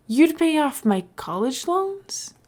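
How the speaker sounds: can't believe the offer; stunned disbelief. Acted.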